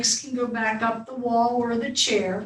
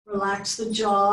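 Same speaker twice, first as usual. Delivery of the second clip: normal talking voice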